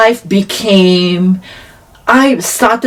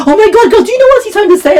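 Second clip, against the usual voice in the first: excited gasp; much faster than usual. Retold or imitated. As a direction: squeal it to your friend. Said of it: "They're like".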